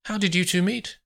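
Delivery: high, innocent-sounding question; feigned innocence. A narrator's reading. Olivia probing how the two met, innocently. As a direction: ask lightly, as if you know nothing.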